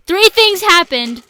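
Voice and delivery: loud announcer-like burst; reading in caps. Acted. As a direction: read it as if all in capitals. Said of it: "the one in caps".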